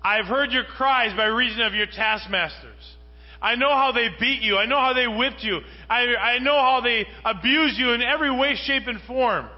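preacher thundering God's promise; impassioned preaching. Acted. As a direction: proclaim each line with rising force. God's compassion recalled, preached.

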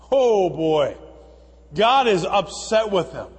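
ordinary talk, nothing marked

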